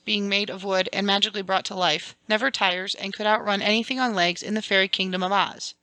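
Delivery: ordinary delivery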